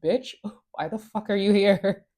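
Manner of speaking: mildly snappy retelling of an outburst; mock snapping. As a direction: imitate an indignant woman confronting someone. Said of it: "She snaps at Elijah".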